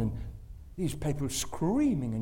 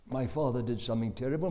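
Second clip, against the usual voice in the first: usual speaking voice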